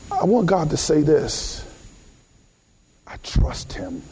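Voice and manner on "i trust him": low and hushed; emotional, quiet trust. Acted. Preacher voices God's trust in him, low and hushed. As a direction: let it fall almost to nothing. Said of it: "get spiritually emotional here".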